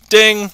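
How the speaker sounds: high loud mock elevator ding; ding like a bell. Mocking the broken elevator with a high loud ding.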